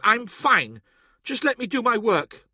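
high-pitched, upset insistence; tearful irritation; snappish. A narrator's reading. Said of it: "she snapped".

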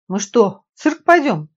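high, loud astonished question; surprised. A narrator's reading. Nadezhda on arriving at the circus, surprised. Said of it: "удивлённо проговорила Надежда".

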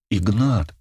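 a touch of entreaty on the name; pleading. A narrator's reading. Марина begging Игнат to understand, pleading. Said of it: "взмолилась Марина".

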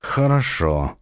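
low, clipped word through clenched teeth; through gritted teeth. A narrator's reading. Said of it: "сквозь зубы процедил Дон Педро".

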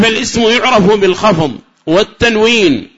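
high, loud formal text reading; reciting the text. Shown as a demonstration. Teacher quoting the grammar text to students, formal recitation.

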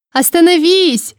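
high, slow desperate shout. A narrator's reading. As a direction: cry out to stop the fight. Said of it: "закричала".

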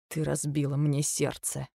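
very soft, slow and broken; husband telling wife she broke his heart; heartbroken. A narrator's reading. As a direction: barely say it, hurt.

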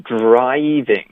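DRIVING drawn out, rounded "dr" opening into "ai"; model pronunciation. Shown as a demonstration. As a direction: stretch driving slowly, sound by sound. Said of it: "后面". (示范慢读 driving)

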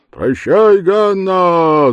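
high, loud call of goodbye; shouted farewell. Acted. A lad calls goodbye to Ганна, shouting high. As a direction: shout it cheekily into the dark. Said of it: "закричал".

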